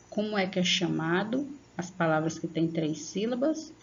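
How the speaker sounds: normal talking voice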